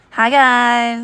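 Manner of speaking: streamer voice. Pretend. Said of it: "that sounds better".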